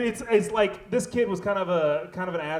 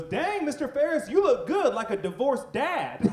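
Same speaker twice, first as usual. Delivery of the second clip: put-on higher teen girl teasing, slowing at the end; sassy student girl; 9 semitones higher than usual. Student teasing teacher about his suit, higher sassy voice. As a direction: tease the teacher in a higher girl's voice. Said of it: "and she goes".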